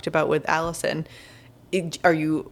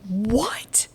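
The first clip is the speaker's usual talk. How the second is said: aghast, higher whispered question; shocked whisper; 5 semitones higher than usual. Real, not acted. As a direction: whisper it in disbelief. Shock that she fell asleep.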